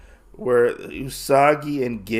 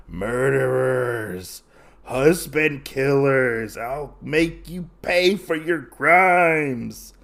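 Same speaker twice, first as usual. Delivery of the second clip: slow, drawn-out villain threat; menacing snake woman; slower than usual. Acted. Snake woman to her husband's killers, slow menacing threat. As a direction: stretch each word out like a vengeful creature.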